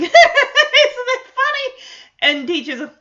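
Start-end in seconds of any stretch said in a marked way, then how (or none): 0.0-1.8 high, loud, drawn-out imitated cackle; exaggerated squealy laughing delivery; mock laughing. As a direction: laugh hard through the words, imitating a gleeful kid. Imitating Stephanie laughing at her joke.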